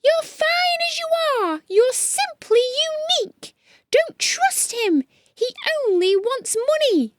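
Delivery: very high tiny squeak; squeaky lion. Acted. Lion warning friends about the chameleon, high squeak. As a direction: plead in a small squeak.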